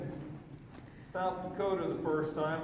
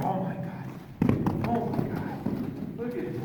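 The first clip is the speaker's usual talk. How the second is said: slow, stretched mock astonishment, rising higher; excited horse voice; 5 semitones higher, 4 dB louder, slower than usual. Trainer speaking for his excited horse, hammed-up amazement. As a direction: play a horse gasping in awe. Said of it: "He's going".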